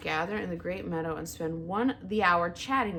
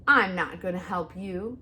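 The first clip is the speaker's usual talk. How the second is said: mild screech; 6 dB louder than usual. A narrator's reading. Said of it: "screeched the owl".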